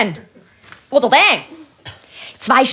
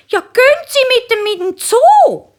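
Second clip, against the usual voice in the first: passer-by asking the man about his penguin; curious woman; 12 semitones higher, 4 dB louder than usual. Acted. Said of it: "die Frau".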